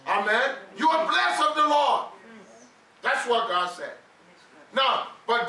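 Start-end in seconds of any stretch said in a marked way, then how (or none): 0.8-2.0 fervent preaching. Acted. Preacher declaring a blessing over the church, fervent. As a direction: proclaim it over them.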